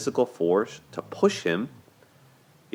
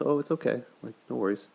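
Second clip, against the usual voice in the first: higher, softer, breezy mock reassurance; easygoing reassurance; 6 semitones higher, 4 dB quieter, faster than usual. Boyfriend telling her it's okay, light soft reassurance. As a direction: brush it off gently, like it's nothing. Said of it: "And he goes".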